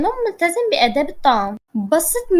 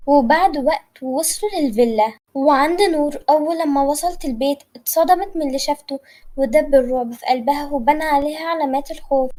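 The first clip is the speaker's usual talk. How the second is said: plain reading voice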